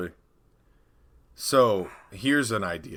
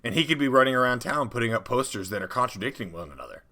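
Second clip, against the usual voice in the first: normal talking voice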